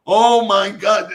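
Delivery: high, loud, drawn-out mock exclamation; overjoyed outcry. Village lady seeing her lost money returned, overjoyed outcry. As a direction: imitate a woman crying out, stretching it slowly.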